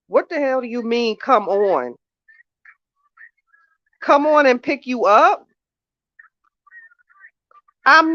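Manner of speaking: high, loud, annoyed arguing; exasperated phone call. Real, not acted. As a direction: let her have it on the phone. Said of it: "put some of this conversation on".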